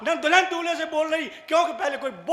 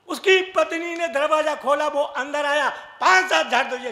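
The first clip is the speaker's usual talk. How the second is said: ordinary delivery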